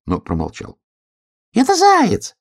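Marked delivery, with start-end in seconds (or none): 1.5-2.3 very high, loud squirrel cry; high-pitched excited call; squirrel shouting. A narrator's reading. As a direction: shout down from a star in a squeal. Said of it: "крикнула со звезды белка".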